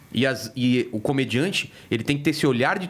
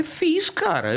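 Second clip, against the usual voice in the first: mock proud; much slower than usual.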